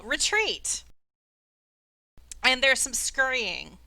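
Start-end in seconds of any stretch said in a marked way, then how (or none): 0.0-0.8 higher-pitched call to fall back; half-voiced battle yell; mild acted yell. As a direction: call it out as a fleeing bystander. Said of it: "someone else yell".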